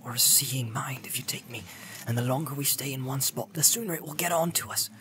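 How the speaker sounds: hushed warning. Acted. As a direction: warn him under your breath.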